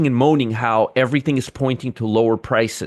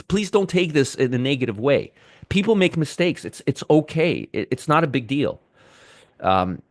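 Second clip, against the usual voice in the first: usual speaking voice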